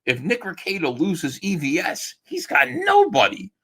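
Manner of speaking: mock alarmed commenter, voice leaping high; imitating an online commenter. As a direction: quote them in a high-pitched mock cry on NOBODY. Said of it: "I saw somebody say".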